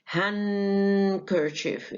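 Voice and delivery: Handkerchief drawn out very slowly with no d; Handkerchief said hankerchief, slowly; silent d. Shown as a demonstration. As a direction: stretch Handkerchief, skip the d. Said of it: "الدي بتسقط".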